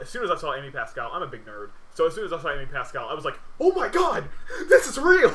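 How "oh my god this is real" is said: very high, loud squeal of disbelief; ecstatic fan outburst. Retold or imitated. Self-described nerd spots Amy Pascal's name, ecstatic outburst. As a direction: burst out in thrilled shock, reliving it.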